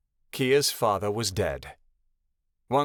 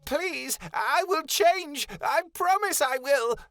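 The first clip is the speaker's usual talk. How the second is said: much higher, slow, frightened pleading; stammering plea; 13 semitones higher than usual. A narrator's reading. Malachi stammering pleas to the Great One in fear. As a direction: stammer out a desperate promise. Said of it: "Malachi stammered".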